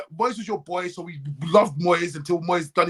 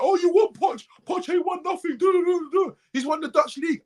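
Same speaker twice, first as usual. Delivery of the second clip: much higher scoffing mock voice; mocking a fan; 10 semitones higher than usual. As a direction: parody a know-it-all fan, pitched way up. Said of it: "Man was like".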